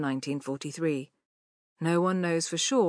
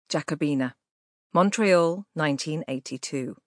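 plain reading voice

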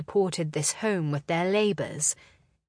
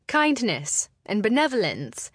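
usual speaking voice

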